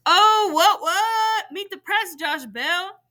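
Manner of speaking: put-on a kid crowd hollering at a crossover. A narrator's reading. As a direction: whoop like kids on the bleachers. Kids hollering from benches.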